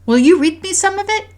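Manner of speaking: put-on high, loud child's plea; eager daughter. A narrator's reading. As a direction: beg Mom eagerly in a little-girl voice. Voices eager daughter asking mother.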